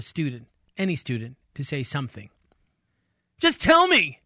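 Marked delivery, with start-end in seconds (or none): 3.4-4.2 high, loud shout. Acted. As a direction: yell it in frustration. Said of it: "he shouted".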